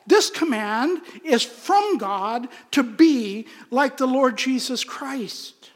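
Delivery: ordinary delivery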